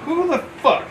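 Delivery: high, sharp indignant question; recruit's startled challenge. Retold or imitated. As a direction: snap it at a stranger.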